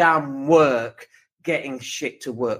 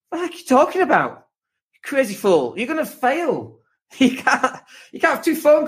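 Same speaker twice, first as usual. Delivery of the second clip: exasperated outburst; 7 semitones higher than usual. Real, not acted.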